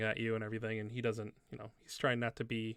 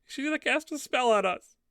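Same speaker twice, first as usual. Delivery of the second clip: put-on high, loud little-girl voice; high-pitched kid blurting out; 13 semitones higher, 6 dB louder than usual. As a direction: pipe up like an accusing small child. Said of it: "the little girl goes".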